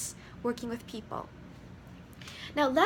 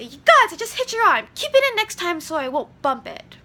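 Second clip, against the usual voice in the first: loud accusing yell, much louder and higher; blamer shouting; 5 semitones higher, 11 dB louder than usual. Shown as a demonstration. Teacher acting a blamer after bumping an arm, yelling. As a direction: bark the blame at them. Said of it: "If I was a blamer".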